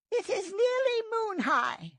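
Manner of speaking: high, far-off leader voice of the clan; old she-cat leader voice. A narrator's reading. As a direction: announce the hour with a catch in the voice.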